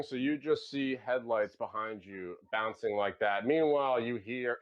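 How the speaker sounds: ordinary talk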